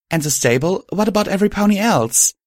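high-pitched, eager questioning; keen, anxious-to-know question. A narrator's reading. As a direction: ask eagerly, pitch jumping right up. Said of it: "I asked eagerly".

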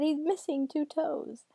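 high, slow, drawn-out cry; distraught repetition. A narrator's reading. Girl stunned by father's injury, slow high wail. Girl repeats her horrified discovery.

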